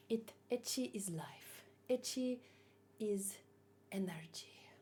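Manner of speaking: soft, low, solemn proclamation; hushed mock-solemn creed about ecchi; hushed mock solemnity. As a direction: preach it softly like a creed.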